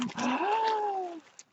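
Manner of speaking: sharp high gasp of delight; a real gasp of surprise. Real, not acted. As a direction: gasp as if you can't believe it. Surprise at a gift.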